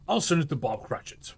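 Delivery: plain reading voice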